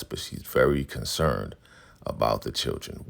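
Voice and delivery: usual speaking voice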